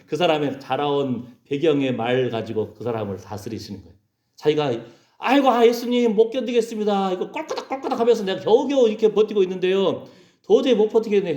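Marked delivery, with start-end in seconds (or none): 5.2-11.3 high, wailing plea; acted cry of someone at breaking point; desperate complaint. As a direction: moan to Jesus that you can't hold on.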